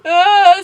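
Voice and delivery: play-acted frightened yelp; mock scared cry. Storyteller acts out her scared face reply, mock fright. Reading out a scared text reaction.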